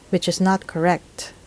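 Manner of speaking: ordinary delivery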